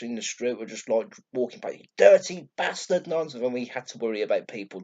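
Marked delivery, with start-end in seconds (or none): 1.7-3.3 high, loud jeer from a passer-by; imitated angry heckle; shouted insult. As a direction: yell abuse across the street. Passers-by shouting abuse at the man.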